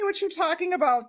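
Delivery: normal talking voice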